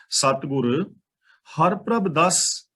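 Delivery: ਸਤਿਗੁਰ then a clear pause, then ਹਰ ਪ੍ਰਭ ਦਸ slowly; ਸਤਿਗੁਰ ਤੋਂ ਬਾਅਦ ਵਿਸ਼ਰਾਮ, ਫਿਰ ਹੌਲੀ ਹਰ ਪ੍ਰਭ ਦਸ; pause after Satgur. Shown as a demonstration. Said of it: "ਇੱਥੇ ਥੋੜ੍ਹਾ ਜਿਹਾ ਵਿਸ਼ਰਾਮ ਲਾਉਣਾ ਹੈ".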